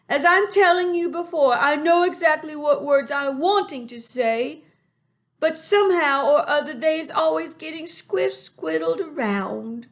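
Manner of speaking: high, loud friendly giant with a muddled tongue; BFG voice. A narrator's reading. Reader voices the giant to listeners. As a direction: lift the voice into the big kind giant. BFG voice in read-aloud story.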